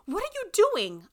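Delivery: loud, very high indignant demand. A narrator's reading. Granddaughter carried off by the man, demanding loudly. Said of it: "I demanded".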